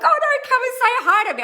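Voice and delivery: high, loud pleading gush; eager plea. Pretend. Pleading with a shy fan to come say hi, high and eager. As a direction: beg them warmly to come over.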